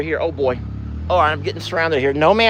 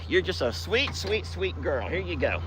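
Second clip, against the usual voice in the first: softer, gentle sweet talk to a cow; soft praise; 4 semitones higher, 11 dB quieter than usual. Acted. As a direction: murmur it fondly as you hand over a treat. Sweet-talking the cow softly.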